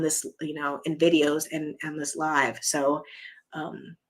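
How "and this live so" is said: normal talking voice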